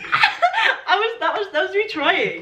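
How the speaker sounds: high, giggly confession; embarrassed laughter lifting the pitch; laughing admission. Real, not acted. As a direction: own up through real laughter. Admits failed accent, laughing.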